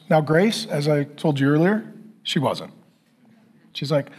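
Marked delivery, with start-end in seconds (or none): none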